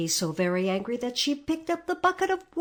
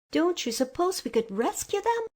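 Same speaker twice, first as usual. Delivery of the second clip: anxious question; 9 semitones higher than usual. A narrator's reading. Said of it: "asked the girl anxiously".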